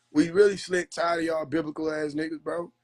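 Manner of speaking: normal talking voice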